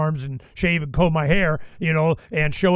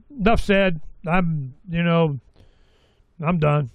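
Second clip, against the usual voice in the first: ordinary delivery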